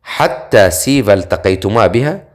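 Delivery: plain reading voice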